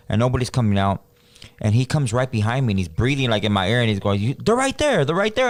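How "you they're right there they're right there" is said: much higher, frantic insisting; scared whisper-high. Retold or imitated. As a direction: voice the tripping cousin, spooked and pitched way up. Cousin on meth pointing at demons.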